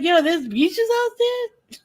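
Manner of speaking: high, slow, laughing mock amazement; amazed. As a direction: laugh through it, stunned that beaches exist. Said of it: "I was like".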